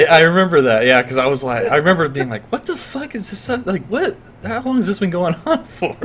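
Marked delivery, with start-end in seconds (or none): 2.5-6.0 high, soft bewildered; confused high voice. Retold or imitated. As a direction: ask it high and lost. Recalling own confused reaction.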